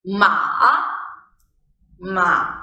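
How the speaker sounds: low dipping "ma"; "ma" sinks deep and bends back up; third tone. Shown as a demonstration. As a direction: drop "ma" low, pause briefly, then go up. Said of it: "this is how it sounds".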